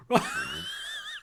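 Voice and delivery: high, voiceless wheezing laugh; helpless laughter. Real, not acted. Reader losing it at his own accent, wheezing laughter. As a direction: crack up completely, real laughter. Cracks up at the pronunciation.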